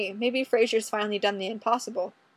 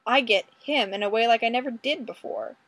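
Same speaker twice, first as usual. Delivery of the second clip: plain reading voice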